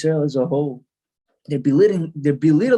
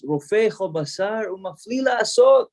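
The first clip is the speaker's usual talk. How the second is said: high, drawn-out proclamation; raised voice, stretching the Hebrew words; loud proclamation; 6 semitones higher than usual. Retold or imitated. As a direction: intone it slowly as a blessing called out. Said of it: "in a loud voice says".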